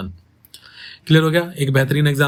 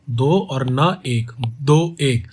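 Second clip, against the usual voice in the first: "दो और न एक" counted out slowly; slow counting of beats; slow syllable count; much slower than usual. Shown as a demonstration. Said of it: "कैसे होगा जश्न".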